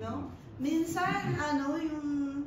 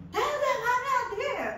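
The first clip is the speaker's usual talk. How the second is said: boss ordering again; 10 semitones higher, 5 dB louder than usual. Retold or imitated. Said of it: "Ganun na naman siya".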